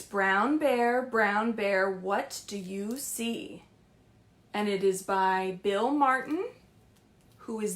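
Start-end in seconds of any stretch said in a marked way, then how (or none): 0.0-3.6 announcing the book title. Acted. Librarian announces picture book title.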